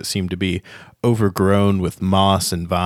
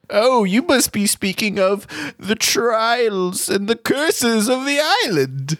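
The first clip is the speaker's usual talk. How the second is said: put-on old gnome wizard; 14 semitones higher than usual. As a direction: play a quirky ancient gnome teasing a newcomer.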